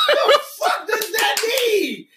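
high, slow, laughing disbelief; baffled outburst. Acted. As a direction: blurt it out in exaggerated bafflement. Baffled by elder's odd saying.

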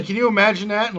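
ordinary talk, nothing marked

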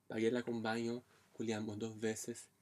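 usual speaking voice

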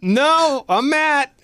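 nervous denial. Retold or imitated. Matt insists on his name to the stranger, high mock nervous. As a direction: insist on it, rattled. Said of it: "And I was like".

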